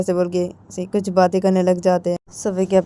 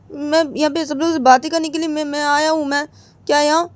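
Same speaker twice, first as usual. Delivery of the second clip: much higher, whiny insisting voice; stubborn protest; 8 semitones higher than usual. Acted. Krishna refusing her papa, high and whiny. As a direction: refuse to leave, childishly stubborn.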